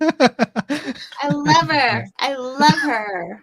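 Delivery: slow, drawn-out I love her; slow, drawn out affection. Real, not acted. Grandfather calling out his love to the host, slow and drawn out. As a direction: stretch each word out fondly.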